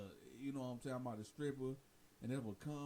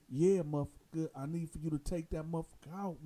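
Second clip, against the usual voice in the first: angry demand; 5 semitones higher, 8 dB louder than usual. Retold or imitated. Inmate demands the hit.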